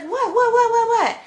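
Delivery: high, loud panicked what. Retold or imitated. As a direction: yelp it out in alarm. Panic as chaos breaks out.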